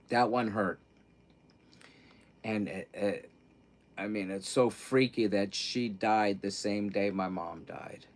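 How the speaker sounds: quiet, near tears, voice fading on "died"; holding back real tears; welling up. Real, not acted. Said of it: "I start crying about Brooke".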